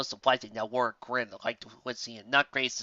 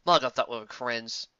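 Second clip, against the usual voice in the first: plain reading voice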